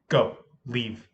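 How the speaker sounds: crisp, controlled order at normal pitch; precise command. Retold or imitated. Sub correcting how they told students to go, precise command. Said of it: "I was much more expl-, uh, precise".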